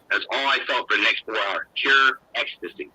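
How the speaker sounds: ordinary talk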